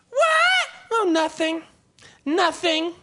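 high, teasing sing-song; taunting kid voice. Retold or imitated. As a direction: tease the sibling cruelly. Sibling exchange acted out.

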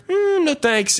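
high, chirpy put-on customer voice; mock customer. The customer turns the offer down.

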